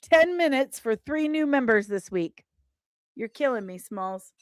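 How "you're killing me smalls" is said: normal talking voice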